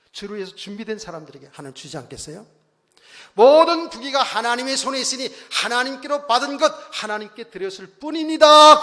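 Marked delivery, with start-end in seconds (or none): 3.4-8.8 loud, high proclamation swelling to a peak; fervent confession. Acted. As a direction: proclaim it as David's joyful confession, full force. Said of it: "이런 고백을 하죠".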